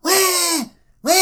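high-pitched fake baby "wah"; exaggerated taunting cry; mock crying wail. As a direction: wail mockingly like a crybaby. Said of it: "and he started crying".

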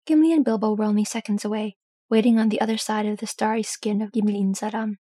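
plain reading voice

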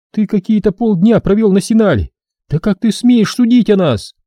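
forced out in disbelief; outraged girl. Acted. Girl outraged at the brother's insult. Drenna defends her people.

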